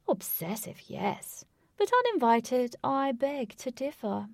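loud, theatrical stalker, preening over his dog; smug villain. A narrator's reading. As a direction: ham up the gloating villain. Voices the villain Lutz.

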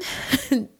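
audible breath between instructions; breath demonstration. Retold or imitated. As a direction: breathe along as the coach would.

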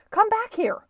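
put-on high, loud boy's call. A narrator's reading. Boy calling to Stuart to return, calling out. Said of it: "he called to Stuart".

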